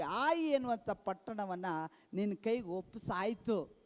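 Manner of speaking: usual speaking voice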